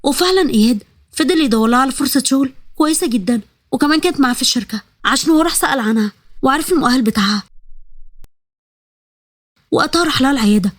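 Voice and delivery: ordinary delivery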